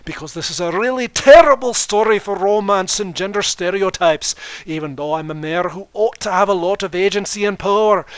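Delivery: put-on Scottish accent, loud, high and growing; Scottish queen accepting with exasperation; Scottish Luna. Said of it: "said Luna scottishly".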